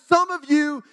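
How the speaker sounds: high, loud imitated preaching; revival preacher. Evangelist addressing a revival congregation, imitated forceful preaching. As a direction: proclaim it like a fired-up evangelist. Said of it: "this man was up there preaching".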